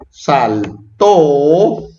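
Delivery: "saltó" drawn out, leaning on "tó"; stress on final syllable. Shown as a demonstration. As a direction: slowly demonstrate "saltó" with a long stressed "tó". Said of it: "hay que ponerle acento para que diga saltó".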